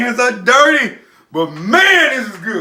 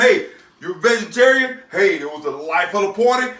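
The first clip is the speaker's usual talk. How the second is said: normal talking voice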